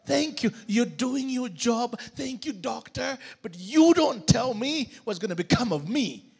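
ordinary delivery

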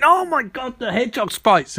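high-pitched, hammed-up alarm; mock panic. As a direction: cry out in pretend terror. Mimicking Barry panicking at spike machine.

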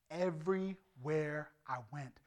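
slowed, weighty restatement of "everywhere I went"; slow emphatic repeat. Shown as a demonstration. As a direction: stretch out "everywhere I went" very slowly.

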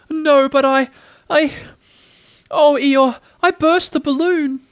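high, loud, halting little voice; falter, then wail out the bad news; Piglet, distraught confession. A narrator's reading. Piglet haltingly confesses bursting balloon.

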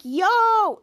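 loud, high drawn-out yo; hearty greeting. Retold or imitated. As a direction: call out to a friend arriving. Greets arriving Schwoz.

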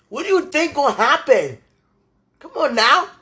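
loud, high, exasperated demand; exasperated outburst. Real, not acted. Upset rhetorical question, repeated louder.

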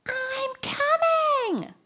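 very high, drawn-out yelled reply; long, high yell back to the group; scream. Retold or imitated. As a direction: holler it long and high over the crowd. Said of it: "I scream".

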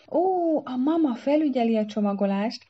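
high-pitched, eager reassurance; reassuring. A narrator's reading. Para telling his bride mama supervises packing, reassuring. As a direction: brush it off brightly, high up. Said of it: "nyugtatta meg aráját Para".

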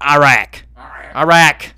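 Iraq said loud as Eye-rack, southern style; hammed-up southern drawl on Iraq; mock southern accent. Shown as a demonstration. Host mocking his own slip, loud southern accent. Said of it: "southern accent going to".

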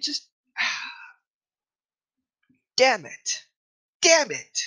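exasperated. Real, not acted. Exasperated outburst.

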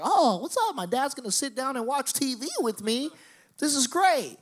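high, gushing naive excitement; naive delight. Retold or imitated. Naive son thrilled Dad will watch TV, high excited voice. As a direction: play the gullible kid thrilled at Dad's attention. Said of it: "I was so young and naive".

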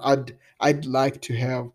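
"I'd" repeated slowly and spelled out, "I'd like to have" at half speed; slow down and isolate "I'd"; slow clear demo. Shown as a demonstration. Teacher slowly isolating the contraction for student, slow demo. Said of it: "sai só um d".

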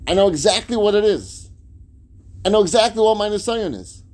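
loud, high, ringing conviction; acted resolve, building through insistent repetition; emphatic declaration. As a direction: drive each phrase home from the pulpit. Preacher voices a determined resolve.

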